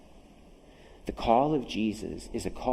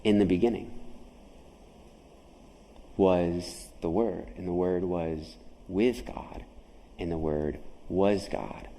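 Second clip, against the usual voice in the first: solemn scripture reading; 5 semitones lower than usual. Acted. Solemn scripture recitation, slowed.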